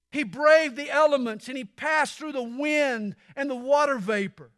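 fervent preacher's cry; preaching at full force. Acted. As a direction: thunder it from the pulpit. Building sermon close.

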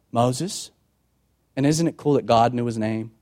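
normal talking voice

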